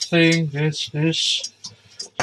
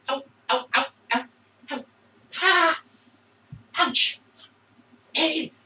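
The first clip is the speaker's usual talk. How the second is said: pained yelps; 8 semitones higher than usual. Real, not acted. Almost burns his hand.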